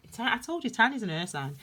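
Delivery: usual speaking voice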